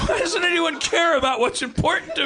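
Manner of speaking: high whine. Acted. Hitler whines to his wife that nobody cares. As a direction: whine it, pitch way up.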